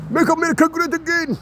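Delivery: high put-on robotic voice; mock Trump slogan. Ranter mimics Trump's slogan in a high put-on voice.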